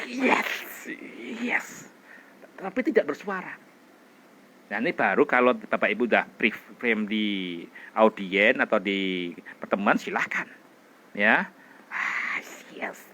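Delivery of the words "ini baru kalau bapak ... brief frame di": normal talking voice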